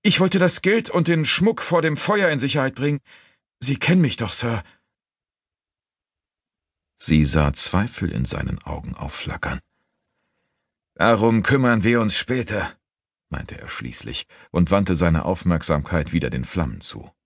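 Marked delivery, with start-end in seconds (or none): 0.0-4.6 high-pitched frantic explaining; desperate pleading. A narrator's reading. Accused woman pleading with doubting master, high and desperate. 10.9-12.7 raised-pitch brisk decision; firm dismissal. A narrator's reading. Master dismissing the matter.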